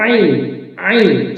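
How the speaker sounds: আইন very slow, squeezed from mid-throat; pressed throat articulation. Shown as a demonstration. Teacher shows learners, slow pressed আইন from mid-throat. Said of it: "চাপ দিয়ে".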